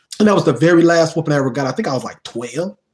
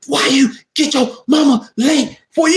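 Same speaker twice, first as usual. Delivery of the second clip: higher auntie voice, each word hit with the belt; auntie scolding; 9 semitones higher than usual. Retold or imitated. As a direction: scold in time with the belt strokes. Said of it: "Every damn syllable, every damn word".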